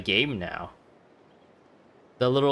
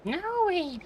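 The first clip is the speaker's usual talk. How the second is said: squeaky high mimic; 3 dB quieter than usual. Mimics the NPC line.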